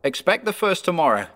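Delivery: high-pitched, solemn spirit; warning ghost. Acted. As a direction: warn as a ghost, pitched well up.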